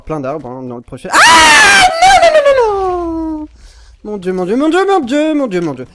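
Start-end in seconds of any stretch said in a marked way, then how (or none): 1.1-3.5 very high, loud, stretched-out screaming noes; terrified scream. Real, not acted. Sur le point de mourir. 4.0-5.9 high and loud, peaking in the middle; frantic praying in panic; panicked. Real, not acted. Panique, fuit les monstres.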